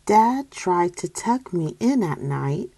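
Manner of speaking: usual speaking voice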